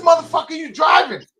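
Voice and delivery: high, loud mock yell of disbelief; retold shout. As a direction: yell it like you can't believe he's driving. Retold shouting at Ish driving drunk.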